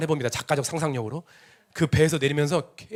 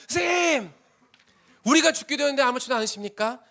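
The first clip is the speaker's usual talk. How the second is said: much higher and louder, crying out in panic; desperate shout; 12 semitones higher, 4 dB louder than usual. Shown as a demonstration. Preacher showing disciples screaming at Jesus in the storm, desperate shout. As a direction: yell it at him like the boat is sinking. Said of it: "이렇게 안 했을 거예요".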